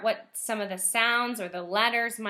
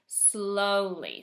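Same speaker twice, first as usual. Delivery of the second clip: normal talking voice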